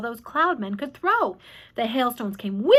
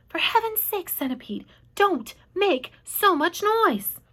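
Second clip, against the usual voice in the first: usual speaking voice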